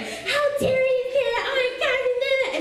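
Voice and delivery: very high, hammed-up outrage; freaking out. Swarm of friends verbally attacking kid, mock high-pitched freak-out. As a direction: imitate outraged friends in a drawn-out squeal. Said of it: "and they're freaking out".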